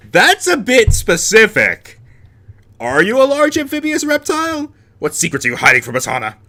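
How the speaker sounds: loud, high, incredulous interrogation; mock outburst. Interrogating Hana about her oddly specific secret, mock outburst. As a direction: blurt it high and loud, as an accusation.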